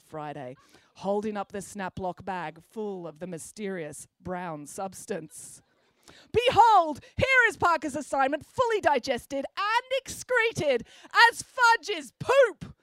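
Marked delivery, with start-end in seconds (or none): 6.3-12.7 high, loud, slow grand proclamation; dramatic reveal. A narrator's reading. Friday presents the bag to the class, loud and grand. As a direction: unveil it like a showman.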